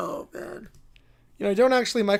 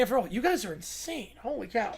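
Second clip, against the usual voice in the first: ordinary talk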